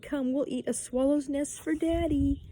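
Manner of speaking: put-on high, singled-out baby-coaxing tone; coaxing mother. A narrator's reading. Mother feeds stubborn Peter, coaxing. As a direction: coax a fussy child to take a bite.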